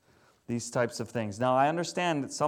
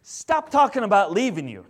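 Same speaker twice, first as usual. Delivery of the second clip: louder, firm vow; indignant Ruth; 4 semitones higher, 7 dB louder than usual. Retold or imitated. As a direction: declare it with stubborn loyalty. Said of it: "Ruth just gets indignant".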